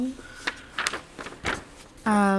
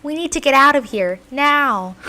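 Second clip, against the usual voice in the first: loud, high urgent order; alarmed cry, raised and forceful; acted panic; 5 semitones higher, 8 dB louder than usual. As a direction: shout to flee, stressing the urgency. Acted script, trio panicking to escape.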